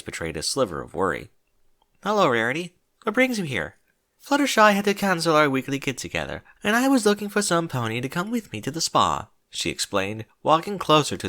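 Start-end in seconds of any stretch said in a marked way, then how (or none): 2.0-3.8 a touch higher, friendly but uneasy; a hint of a worried young unicorn; lightly voiced Twilight. A narrator's reading. 4.2-9.3 a touch higher, genteel lady; a hint of refined charm; lightly voiced Rarity. A narrator's reading.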